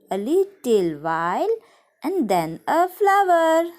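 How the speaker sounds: put-on sing-song rhyme for children; poem recitation. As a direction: recite it gently, line by line.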